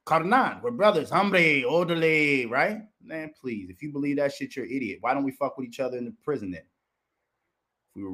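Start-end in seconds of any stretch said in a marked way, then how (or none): 0.1-2.8 raised mock Mexican-accented banter; exaggerated Mexican accent, pitched up. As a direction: ham up a Mexican homeboy accent in mockery. Mocks claimed brotherhood in Spanish slang.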